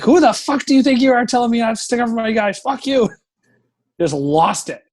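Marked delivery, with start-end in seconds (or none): 0.0-3.1 higher, fast, furious outburst at the coach; retold furious yell. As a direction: spit it out fast and high like losing it at him. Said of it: "And I'm like".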